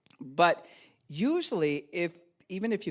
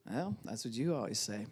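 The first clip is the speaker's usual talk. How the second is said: soft muttered jab; under the breath; 4 semitones lower, 6 dB quieter than usual. Retold or imitated. Gaslighter slipping in a jab, softly under breath. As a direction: say it low, just under your breath. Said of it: "It can just be under the breath even".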